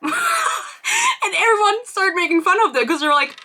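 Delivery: high laughing shout, then slows down; laughing outburst. Real, not acted. Storyteller cracking up over fans mocking the band, shouting with laughter. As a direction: bursts out laughing as she tells it.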